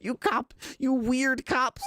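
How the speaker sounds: agudo y lento, con burla; mocking troll. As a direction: alarga "weird cops" burlándote.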